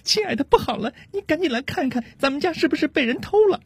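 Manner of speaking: very high-pitched wife voice in a mild panic; alarmed wife. Acted. Wife asks husband if they were robbed, alarmed high voice. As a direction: call your spouse over, worried, in a high feminine voice. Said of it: "于是妻子就马上问丈夫".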